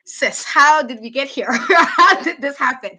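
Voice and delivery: stunned at the blunder; incredulous sis. Retold or imitated. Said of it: "I was just like".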